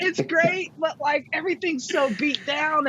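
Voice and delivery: high, hammed-up weepy whine; retold tearful complaint. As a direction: imitate whining to parents through tears. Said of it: "I'm crying".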